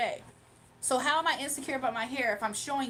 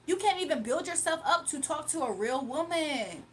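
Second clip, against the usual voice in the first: ordinary delivery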